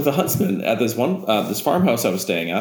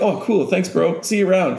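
lightly acted, higher-pitched breezy goodbye; friendly send-off; 9 semitones higher than usual. Man to spider, peaceful send-off, lightly acted high voice. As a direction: toss off a cheery farewell in a raised voice.

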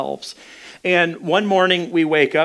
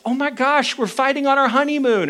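high-pitched upset wife impression; 9 semitones higher than usual. Wife to husband as they spiral into a fight, mock upset. Wife acted in honeymoon quarrel.

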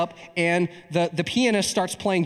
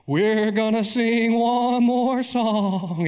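high, drawn-out wobbling church voice; mock a preacher's quavering altar-call intonation; tremolo pastor voice; 5 semitones higher, much slower than usual. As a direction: stretch every word in a ghoulish tremolo. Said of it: "He's got this tremolo voice".

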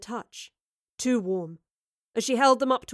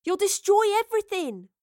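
high, louder alarmed cry; urgent high-pitched outburst; desperate cry; 10 semitones higher, 5 dB louder than usual. A narrator's reading. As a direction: cry out a warning over the roar. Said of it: "Astrid cried above the hum".

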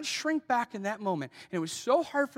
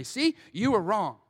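gloating; 2 semitones higher, 6 dB louder, slower than usual. Retold or imitated. Gloating over the person who corrected him.

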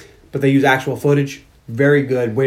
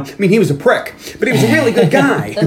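high-pitched, emphatic put-on interviewee; 6 semitones higher than usual. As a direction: insist on it in a high mock-testimonial voice. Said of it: "[name] talking about the Gong Show".

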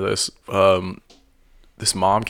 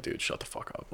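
muttered retort; 15 dB quieter than usual. Retold or imitated. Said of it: "I was like".

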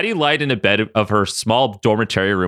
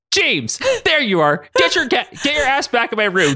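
yelling; 11 semitones higher than usual. A narrator's reading. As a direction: call the cat sharply, raised pitch. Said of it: "Katie yelled".

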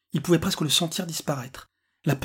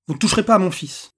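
louder, higher protective father; defiant warning; 5 semitones higher, 6 dB louder than usual. A narrator's reading. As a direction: warn them off forcefully. Le père s'interpose face aux policiers. (avertissement ferme, voix forte et montée)

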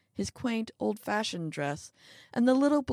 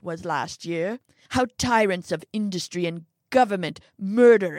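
angry tirade; 5 dB louder than usual. Acted. Old man rants about humanity.

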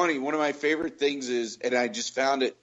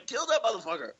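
imitating dad, much higher, mock battle cry; a fan urging the hero on, pitched up; cheering dad; 9 semitones higher, 4 dB quieter, faster than usual.

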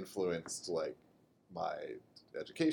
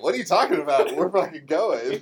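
loud, high-pitched brash overruling; mock indignant dismissal; 6 semitones higher, 7 dB louder than usual. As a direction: brush off the objection loudly, mock gung-ho.

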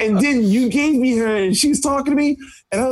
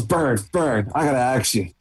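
lower, confiding friend; low serious voice; 9 semitones lower than usual. Retold or imitated. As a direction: drop the voice low to ask. Retold friend asking.

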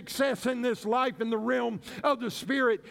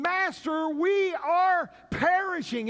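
higher, louder, slow plea to the master; desperate cry; 6 semitones higher, 3 dB louder, slower than usual. Retold or imitated. Disciples in the storm crying to their master, desperate and loud. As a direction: cry out like you're taking your last breaths. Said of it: "that's what Luke was saying".

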